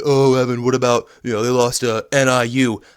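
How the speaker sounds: taunting rival fan, lightly voiced; mocking the haters. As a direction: sneer it like a smug Notre Dame hater. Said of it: "what you Notre Dame haters are gonna say".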